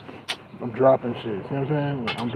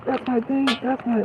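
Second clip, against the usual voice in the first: high nasal-free cartoon squeak, much higher; Squidward voice; 13 semitones higher than usual. Vlogger mimicking Squidward from a trap remix, high cartoon voice.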